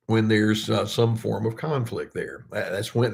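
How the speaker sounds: usual speaking voice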